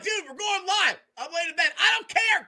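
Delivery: very high, shouted hype; excited shout. Retold or imitated. Podcaster rousing his co-host at night, shouting high and hyped. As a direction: yell at your buddy to get up and go live. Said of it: "I'm like".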